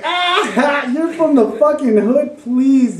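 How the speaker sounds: high, scoffing jeer at a boast; mocking disbelief. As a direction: laugh off the kid's tough claim. Teller jeers at kid's boast.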